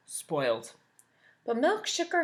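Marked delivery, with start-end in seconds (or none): 0.1-0.7 low, dry one-word jab; Waffle's voice. Acted. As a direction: drop it low and flat. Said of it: "Waffle looked at Carrot and said".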